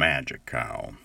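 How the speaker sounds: low southern drawl on magic cow. Shown as a demonstration. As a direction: drop the voice into a slow southern drawl. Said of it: "Maybe a southern drawl".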